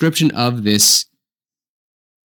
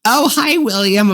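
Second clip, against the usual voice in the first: high, chirpy mock greeting; imitating mistaken greeters; 10 semitones higher than usual. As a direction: greet someone in a put-on, much higher voice. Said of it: "how many Williams I get".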